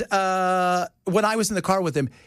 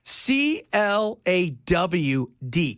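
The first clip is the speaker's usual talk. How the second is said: slow, letter by letter; spelling out; much slower than usual. Shown as a demonstration. Host answers how the name is spelt, slow separate letters. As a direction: spell it out slowly and distinctly. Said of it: "how do you spell that".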